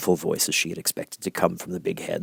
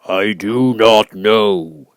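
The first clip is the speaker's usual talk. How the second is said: booming, much louder, very slow voice of the giant head; great and terrible Oz; 9 dB louder, much slower than usual. Acted. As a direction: loom over her, slow and thundering. Oz answers.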